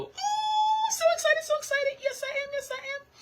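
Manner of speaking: very high squealing delight; high-pitched giddy yes-I-am squeal. Retold or imitated. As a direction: squeal it like a thrilled fan. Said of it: "made me kinda go".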